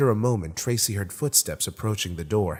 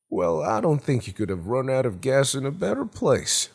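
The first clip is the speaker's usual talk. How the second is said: cheery, higher-pitched hearty host voice; Gerald Jones, cheery; 5 semitones higher than usual. A narrator's reading. Homeowner cheerily reassures a stranded driver, in a higher character voice. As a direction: welcome him with chirpy good cheer. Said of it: "the guy said cheerily".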